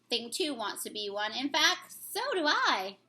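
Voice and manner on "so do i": ordinary talk, nothing marked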